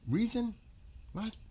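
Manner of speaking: a scornful high-pitched echo; incredulous scoff. Real, not acted. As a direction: throw the word back in disbelief. Scoffs at diplomacy idea.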